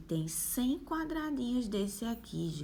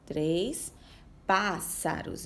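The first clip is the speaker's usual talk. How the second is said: slow reading aloud; much slower than usual. Shown as a demonstration. Teacher reads picture word slowly.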